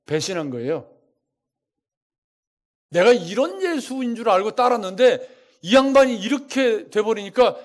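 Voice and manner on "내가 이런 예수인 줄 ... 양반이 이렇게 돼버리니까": preaching at full force. Acted. Voices crowd's anger, preaching.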